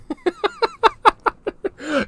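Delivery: high-pitched laughing fit; high, helpless laughter. Real, not acted. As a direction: crack up laughing. Cracking up at his joke.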